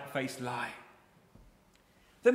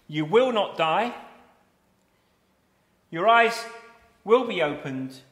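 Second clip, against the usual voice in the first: loud, punchy devil's promises; forceful counterclaims; 7 dB louder than usual. Acted. Preacher booming Satan's promises to Eve. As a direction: hurl the lies out boldly.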